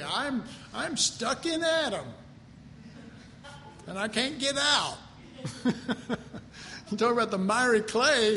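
ordinary talk

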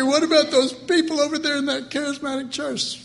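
high, whining complaint; whiny question. Acted. Believer comparing himself to others, high and whiny. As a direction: ask it like a jealous complainer.

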